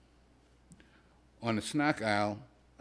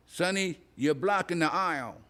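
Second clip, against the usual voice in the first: put-on higher, crotchety old woman's voice; old lady; 7 semitones higher than usual. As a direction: scold him like a cranky grandma. Old woman scolds narrator.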